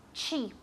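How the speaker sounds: Cheap with a hard ch and a final p that lets the air out; teaching pronunciation. Shown as a demonstration. Teacher to class, modelling Cheap with an aspirated final p. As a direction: say Cheap, ch at the start, puff of air on the p. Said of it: "Tiene que salir aire".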